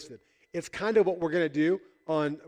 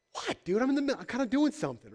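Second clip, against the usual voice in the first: high-pitched comic protest at being interrupted; mock indignant; 7 semitones higher than usual. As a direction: play put-out disbelief, pitched up. Imagined Jesus annoyed at interruption.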